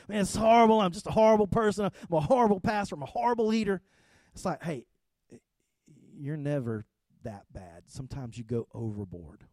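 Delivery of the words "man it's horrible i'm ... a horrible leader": self-pitying rant. Retold or imitated. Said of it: "I'm like".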